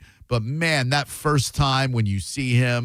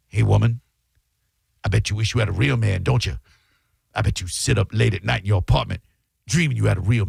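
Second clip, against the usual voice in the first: lower, quick leering taunt; sleazy Clubber Lang; 5 semitones lower, faster than usual. Retold or imitated. Host voices Clubber Lang taunting Adrian, low fast sleazy sneer. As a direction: leer at her, rushing the lines.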